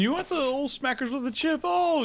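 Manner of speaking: put-on high, squeaky old English voice; renaissance fair olde speech. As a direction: squeak it like a mock Brit. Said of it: "talking in old English".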